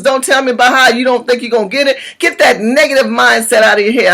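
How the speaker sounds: ordinary delivery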